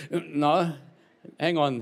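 ordinary talk

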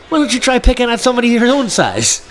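high hammed-up defiance; mock challenge. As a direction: dare him with mock bravado. Uncle challenging friend.

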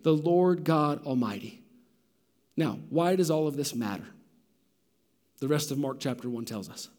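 normal talking voice

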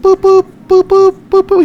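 high, loud robotic beeping; robot beeps. Pretend. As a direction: beep like a machine. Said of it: "using the robot voice".

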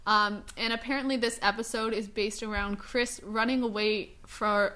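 normal talking voice

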